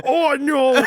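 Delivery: hammed-up oh no through a laugh; mock dismay. Nathaniel and Kitty spotting two enemies, mock dismay. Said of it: "and they go".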